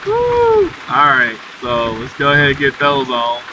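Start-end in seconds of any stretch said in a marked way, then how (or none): 0.1-0.7 a high, loud whoop; excited woo. Real, not acted. Streamer whoops as rain starts in the game. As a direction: let out a happy woo.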